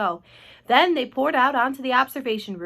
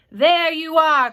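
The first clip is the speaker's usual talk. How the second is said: loud, slow, raised exclamation; police chief crowing loudly; triumphant cry; 6 semitones higher, 10 dB louder, much slower than usual. A narrator's reading. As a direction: cry it out as if proved right. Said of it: "cried the chief of police".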